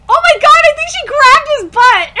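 high, loud squealing disbelief with a laugh; scandalised fan shrieking with laughter. Real, not acted. Squealing at butt grab.

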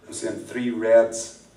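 I'm seeing three reds, drawn out slowly; slow reveal. Acted. Mentalist reveals card colours to volunteers, slow and dramatic. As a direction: let reds land slowly. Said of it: "mentalist reveal".

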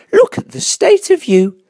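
high, shocked mother bear; high-pitched motherly astonishment; shocked gasp. A narrator's reading. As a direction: exclaim in dismay at a messy child. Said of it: "she gasped".

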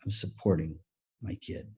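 low, soft, with weighty pauses; heartfelt sincere. Retold or imitated. Astronaut dad explaining his priority, heartfelt. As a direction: let the words sink in quietly.

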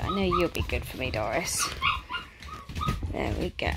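soft whisper to a puppy; gentle whisper. Real, not acted. Trainer soothing Doris while giving pills, whispered. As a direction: whisper her through the pill.

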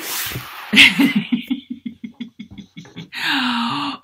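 long, high tittering giggle. Pretend. Puppet sees Missus Jones, long high giggle. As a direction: giggle on and on, then let out a breath.

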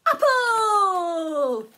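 high loud puppet cry of Apple; puppet squeal. Pretend. Sock puppet crying out the fruit, high and loud. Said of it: "Can you make those sounds?".

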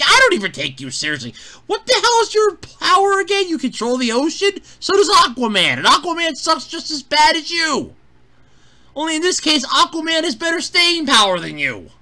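scornful mock rant. Viewer telling off the ocean villain, mock scornful. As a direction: take the villain apart in exasperated disbelief.

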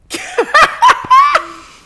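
high, loud burst of real laughter. Real, not acted. Big laugh at own joke.

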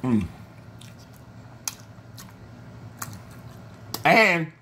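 talking between bites; chewing while talking. Real, not acted. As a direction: mouth partly full. Seen 1: Bit wing at -5; closed, working mouth until 0.8, wing held.

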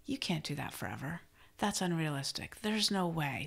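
softly planting doubt; lightly voiced inner saboteur, hushed; whispering saboteur. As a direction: undermine them quietly, keeping it soft. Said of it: "the saboteur is gonna whisper".

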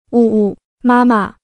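slow, sobbing plea to her mother; weeping as she clings to her new mother; tearful sob. A narrator's reading. Adopted girl sobbing into mother's arms, tearfully slow. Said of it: "大颗大颗的泪水顺着她的脸颊滑下".

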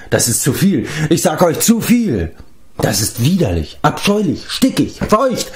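increasingly high, desperate wail; building desperation. A narrator's reading. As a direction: start pleading and climb to a desperate cry. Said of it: "schrie er verzweifelt". (zunehmend verzweifelt klagend)

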